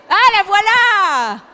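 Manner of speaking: loud, slow, delighted "la voilà"; excited exclamation. Real, not acted. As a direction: greet it like a long-awaited friend. The giraffe slide finally appears.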